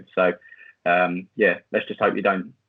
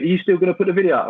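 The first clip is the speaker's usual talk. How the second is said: higher, louder, fast challenge; a hint of a pushy steward; imitating the stewards; 10 semitones higher, 6 dB louder, faster than usual. Stewards challenge the vlogger as he leaves, higher and louder. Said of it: "they went".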